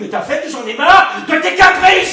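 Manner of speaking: loud, high mimicking retort; exasperated scolding. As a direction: throw it back at them, shouting. Director mocks and scolds crying actress.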